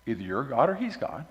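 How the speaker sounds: ordinary talk, nothing marked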